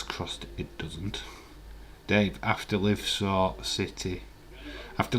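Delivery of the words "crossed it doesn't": soft hopeful whisper. Real, not acted. Hoping to Heather the cancer stays away, whispered. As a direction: whisper it like a wish.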